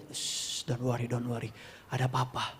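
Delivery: soft, low hushing comfort; soothing child. Retold or imitated. Father comforting his crying son, soft hushed reassurance. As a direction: hush him gently.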